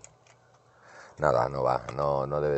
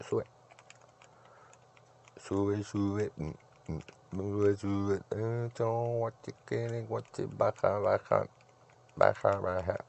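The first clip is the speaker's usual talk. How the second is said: sing-song urging; 6 semitones higher, slower than usual. Real, not acted. Higher sing-song urging at game.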